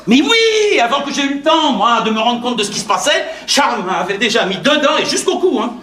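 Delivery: high, agitated protest; exasperated self-justification. Acted. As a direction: blurt out your defence, pitched high. Se justifie avec indignation. (justification exaspérée, voix haut perchée)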